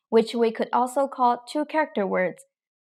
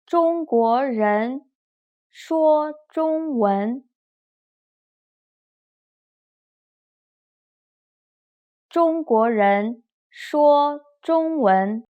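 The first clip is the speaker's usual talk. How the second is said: slow, carefully toned Mandarin sentence; slow phrase-by-phrase drill; read-after-me model; slower than usual. Shown as a demonstration. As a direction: model it slowly for learners to repeat. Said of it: "Please read after me".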